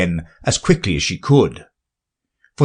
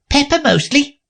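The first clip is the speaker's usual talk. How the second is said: high gruff cook; blunt cook answer. A narrator's reading. The cook voiced.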